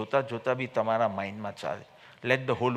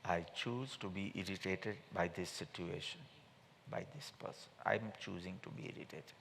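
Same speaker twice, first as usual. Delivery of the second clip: slow, much softer, almost under the breath; soft inner statement; 5 semitones lower, 17 dB quieter, slower than usual. Shown as a demonstration. Teacher voicing the right belief, hushed and slow. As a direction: say it quietly to yourself like a private admission. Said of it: "right belief will be".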